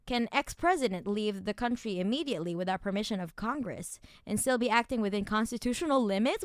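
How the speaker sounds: usual speaking voice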